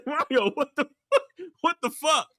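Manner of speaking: high voice breaking up with laughter; laughing mock outrage. Retelling Pac-Man confronting Mario, cracking up. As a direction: try to act outraged through giggles. Repeats acted outburst while laughing.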